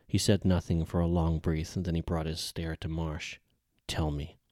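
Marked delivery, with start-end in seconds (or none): 3.9-4.4 quiet menace. Acted. Commander's grim stare after silence.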